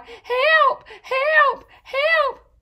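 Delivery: high, loud panicked cries; crying for help. A narrator's reading. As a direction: shriek for help like frightened animals. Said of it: "the horse, cried".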